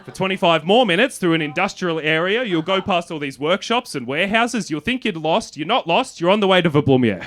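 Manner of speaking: loud, high, animated directions. Retold or imitated. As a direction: enthusiastic local urging a traveller on. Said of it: "became very animated".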